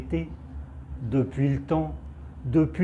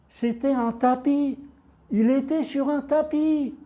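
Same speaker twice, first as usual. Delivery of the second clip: much higher, held-up pitch of astonishment; amazed realisation; 10 semitones higher than usual. A narrator's reading. Narrator reveals the man is on a carpet, amazed. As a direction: narrate the discovery in high-pitched wonder.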